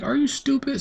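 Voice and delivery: very high, incredulous squeak of a question; mock disbelief. Mocking the boy who ran out on the wrong name, high and incredulous. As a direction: ask it in outraged disbelief. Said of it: "I'm like".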